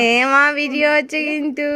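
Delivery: loud, high sing-out warning; calling out. Real, not acted. Mother warns the others the video is on, calling out.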